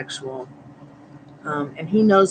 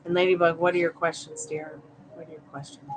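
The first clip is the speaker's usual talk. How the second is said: ordinary talk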